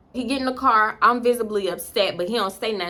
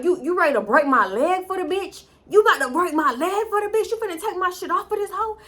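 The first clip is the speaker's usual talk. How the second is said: retold yelling; 10 semitones higher than usual. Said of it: "We still yelling".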